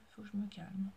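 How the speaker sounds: a hushed self-reminder; whispered to herself. Real, not acted. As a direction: whisper it, telling yourself to calm down. Repeated self-admonition, whispered. (chuchoté pour soi-même, comme pour se raisonner)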